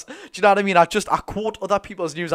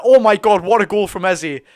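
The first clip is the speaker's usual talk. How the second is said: loud burst of real amazement at a great goal; amazed shout; 4 semitones higher, 13 dB louder than usual. Real, not acted. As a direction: shout it out, thrilled by the strike.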